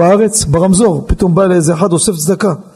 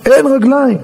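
higher, insistent repeat; emphatic alarm; 6 semitones higher than usual. Retold or imitated. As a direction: drive it home with raised pitch. (בדחיפות ובבהלה)

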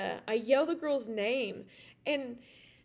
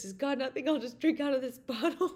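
higher mock-defiant voice cracking into laughter; raised, incredulous quote ending in a laugh; laughing retelling; 4 semitones higher, 4 dB louder than usual. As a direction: act out his cheeky retort and lose it laughing. Retold defiant joke, breaking into laughter.

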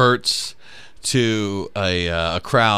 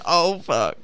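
laughing; 8 semitones higher than usual. Retold or imitated. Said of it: "you can tell he's like".

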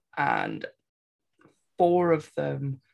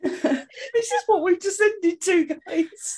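laughing; 12 semitones higher, slower than usual. Real, not acted. Laughing despair.

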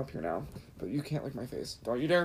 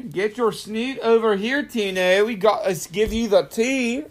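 high, goofy put-on accent; mock Irish-like accent; 6 semitones higher, 4 dB louder than usual. Calling the dog over for tea, mock Irish-like accent.

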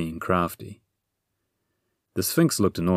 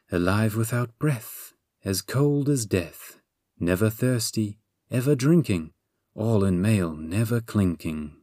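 riddle recitation; 2 semitones higher than usual. A narrator's reading. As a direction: pose the riddle line by line, drawn out.

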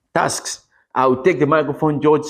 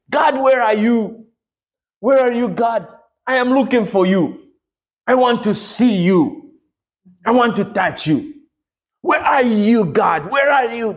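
high, insistent cry to God; calling out in prayer; 6 semitones higher than usual. Retold or imitated. Man standing in prayer, searching for God, pleading aloud. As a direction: call out searchingly, each plea pitched high. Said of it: "And I will stand and say".

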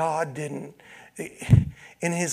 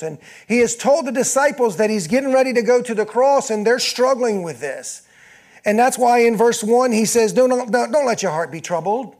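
loud, high, urgent pulpit voice; full-force preaching; 6 semitones higher, 7 dB louder than usual. Acted. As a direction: preach it out to the whole room.

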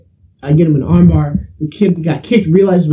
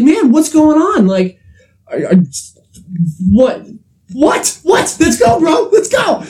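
higher and higher mock yell daring him to fight; retold challenge; 10 semitones higher, slower than usual. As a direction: rise to a riled-up shout of "Let's go". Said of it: "I'm like".

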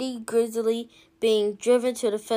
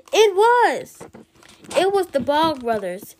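higher, louder exclamation; dramatic reveal; 6 semitones higher, 5 dB louder than usual. A narrator's reading. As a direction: land the reveal with a jolt. Narrator reveals dreaded arrivals.

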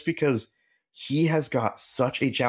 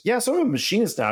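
much higher, fast, offhand; breezy friend; 11 semitones higher, much faster than usual. Retold or imitated. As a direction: toss it off like it's no big deal. Imitating friend's casual announcement.

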